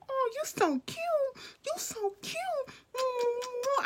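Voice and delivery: put-on very high, slow cooing over a baby; high, drawn-out baby voice; cooing baby talk. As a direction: gush at the infant in a sing-song coo.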